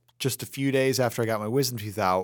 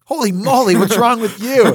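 high, loud shocked exclaim; shocked coach; 10 semitones higher, 10 dB louder than usual. Retold or imitated. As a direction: blurt it out in alarm. Coach shocked at swollen face.

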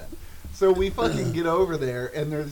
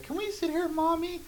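put-on high, small child's plea; little kid voice; 13 semitones higher, 5 dB quieter than usual. Kids asking their mommy for the seats, high childlike plea. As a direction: ask like a little kid begging mom.

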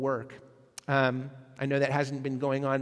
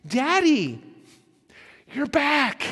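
put-on high, loud, thrilled child voice; overjoyed child greeting; squeal with delight; 10 semitones higher, 6 dB louder, slower than usual. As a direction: squeal it like a kid seeing a parent come home. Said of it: "squeal with delight".

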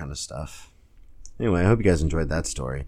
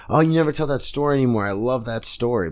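higher, louder gushing fan; eager fan; 7 semitones higher, 5 dB louder than usual. Retold or imitated. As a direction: gush like a fan after a show. Fans greeting him after shows.

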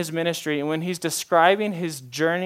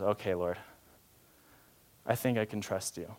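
low, hushed, accepting; softly yield, with a pause to take it in; trusting surrender; 6 semitones lower, 10 dB quieter than usual. Acted. Believer answering God after hearing love, trusting. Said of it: "in that tone".

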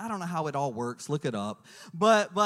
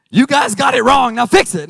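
much louder barking demand; mock demand; 5 semitones higher, 18 dB louder than usual. Husband pretending to yell at the lab lady, mock loud demand. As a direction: pretend to snap at the clerk. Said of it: "No, I'm just kidding".

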